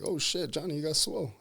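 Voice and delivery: light retold amazement; mock surprise. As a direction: imitate the friend's amazed reaction. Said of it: "it's like".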